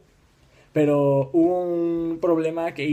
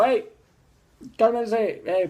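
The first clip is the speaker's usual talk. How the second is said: Moctezuma; 7 semitones higher, slower than usual. Retold or imitated. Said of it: "fue Moctezuma y les dijo".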